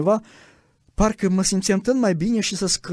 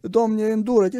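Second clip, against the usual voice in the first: imitated fervent prayer; 6 semitones higher, slower than usual. Believers around him praying aloud, imitated fervent pleading. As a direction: cry out to God like the crowd around him. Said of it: "Eu auzeam pe alții că zice".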